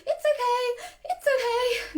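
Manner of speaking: high-pitched, startling repeated soothing; panicked reassurance. Shown as a demonstration. Owner teaching the dog alarm, high and panicky. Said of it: "quite startling and quite high-pitched".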